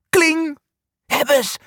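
high, excited got-it cry; triumphant. A narrator's reading. Angsthaas grabs the key, high triumphant cheer. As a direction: ring out the key, then cheer.

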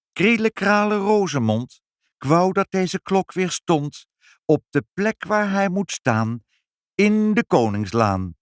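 high, declaimed spell slowing to a close; magic incantation. A narrator's reading. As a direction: intone the wish ceremoniously. Mother speaks a magic wish.

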